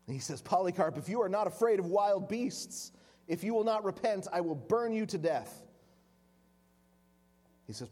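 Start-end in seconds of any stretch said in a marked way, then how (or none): 0.4-5.7 louder and higher, a mild hammed-up menace; angry threat. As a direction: threaten him with fire. Proconsul threatens burning.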